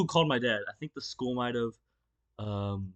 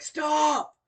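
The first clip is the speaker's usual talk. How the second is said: panicked cry; 13 semitones higher, 5 dB louder than usual. Retold or imitated. Panicked cry while being slammed.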